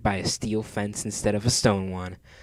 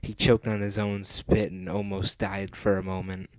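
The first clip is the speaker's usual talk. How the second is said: plain reading voice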